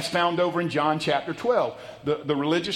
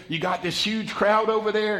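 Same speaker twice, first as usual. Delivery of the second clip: ordinary talk, nothing marked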